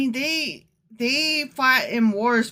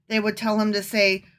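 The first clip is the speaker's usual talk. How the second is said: ordinary delivery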